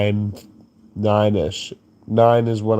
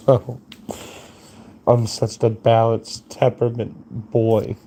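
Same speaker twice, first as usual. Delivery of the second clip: ordinary delivery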